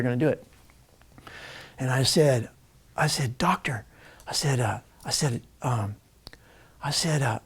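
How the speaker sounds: jaw-wired whisper to the doctor. Retold or imitated. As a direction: breathe the words out through shut teeth.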